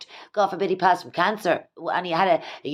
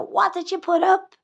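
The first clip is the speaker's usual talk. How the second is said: much higher incredulous squeal; mock disbelief; 12 semitones higher than usual. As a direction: squeak it out as your future self in disbelief. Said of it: "look back and go".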